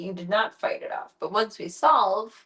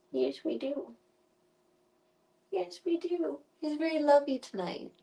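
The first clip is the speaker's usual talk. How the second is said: put-on baby talk; 6 semitones higher, 5 dB quieter than usual.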